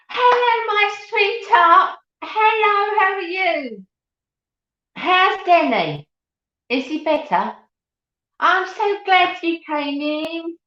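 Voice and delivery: high, bright-eyed hello to a friend, slowed and stretched; delighted greeting. Real, not acted. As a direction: greet her like a dear friend arriving. Greeting a viewer joining live.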